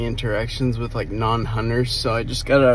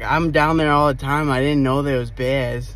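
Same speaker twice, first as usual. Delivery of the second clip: imitated Boston guy, louder; mock Boston accent, a bit raised; thick Boston accent; 3 semitones higher, 5 dB louder than usual. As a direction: lightly put on a Boston construction worker's voice. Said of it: "thick as Bo-, Boston accent".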